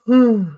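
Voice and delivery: long, loud, rising yawning hum; real yawn. Real, not acted. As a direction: let a real yawn escape. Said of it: "I gotta quit yawning".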